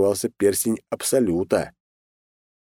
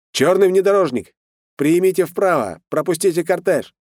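shouted through a megaphone; loudspeaker voice; 10 semitones higher, 4 dB louder than usual. Acted. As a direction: bark orders over a police loudspeaker. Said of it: "голос по громкоговорителю".

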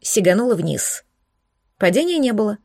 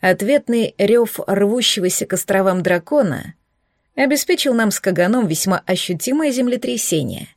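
ordinary delivery, nothing marked

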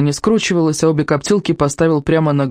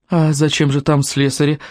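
plain reading voice

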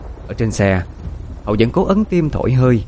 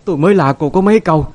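higher, louder furious screaming, building to a shriek; screaming in grief; 7 semitones higher, 4 dB louder, faster than usual. A narrator's reading. Grandmother blaming her daughter-in-law for the child's death, screaming. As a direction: hurl it at her like a curse, shrieking. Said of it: "Bà Lan gào thét".

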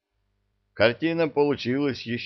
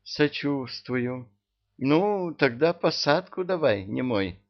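slow mock sympathy, then high and teasing; teasing witch; 6 semitones higher than usual. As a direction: tease him like a playful witch.

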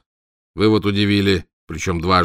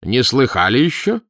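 proudly; 5 semitones higher, 5 dB louder than usual. A narrator's reading. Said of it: "спросил он горделиво".

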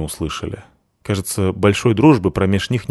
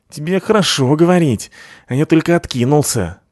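whiny thug voice; 7 semitones higher than usual. A narrator's reading. Second thug whining.

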